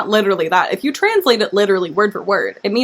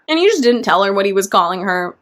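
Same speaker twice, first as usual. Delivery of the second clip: normal talking voice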